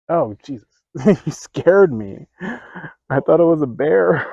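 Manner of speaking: loud, high-pitched fright; startled outburst. Real, not acted. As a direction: cry out after a sudden scare. Startled by supposed bear while recording.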